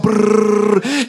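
rolled brrr for furious typing; drawn-out trilled brrr, imitating fingers flying; long trilled typing sound effect; typing noise. Imitates frantic typing on Facebook.

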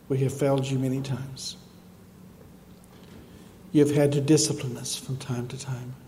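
fervent closing prayer. Real, not acted. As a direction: pray aloud for the whole church.

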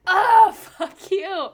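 high, drawn-out "oh" breaking into laughter; loud mock outrage. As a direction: explode at the rejection, half laughing. Acting out reaction to rejection email.

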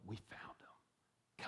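slow, breathless whisper; awed whisper. Retold or imitated. Andrew whispers to his brother Simon, awed. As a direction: whisper it like a precious secret.

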